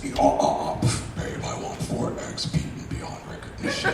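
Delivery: lightly voiced robot hero ordering breakfast; mock-grand robot answer; Optimus Prime voice. Husband answers wife's breakfast question, lightly voiced Optimus Prime. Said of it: "The fuck is wrong with you, the Optimus Prime?".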